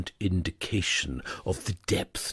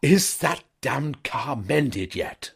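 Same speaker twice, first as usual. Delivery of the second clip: slow, higher hoarse croak; 8 semitones higher, 4 dB louder, slower than usual. A narrator's reading. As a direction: croak it out, rattled. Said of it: "he said hoarsely".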